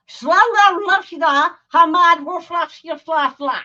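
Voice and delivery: "Shlala Rashida" garbled with hacking throat sounds; loud, throaty mock-foreign gibberish; mock throat-clearing gibberish. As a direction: mangle "Shla Shla" as if coughing up phlegm. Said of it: "they sound like ... coughing up a Louis".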